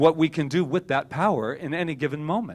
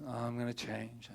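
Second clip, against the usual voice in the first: much softer, half-hearted resolve; reluctant mumbled promise; 3 semitones lower, 13 dB quieter than usual. Acted. Speaker mocks putting off change, reluctant mumbled promise. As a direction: promise it quietly without conviction.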